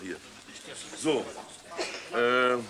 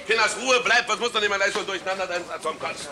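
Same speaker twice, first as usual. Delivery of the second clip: higher, louder orders to the crew; exasperated; 7 semitones higher, 4 dB louder than usual. Acted. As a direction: push everyone to finally shoot. Exasperated director rallies the crew.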